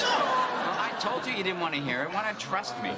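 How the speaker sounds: high-pitched indignant protest; defensive protest. Acted. Protests after his story falls flat.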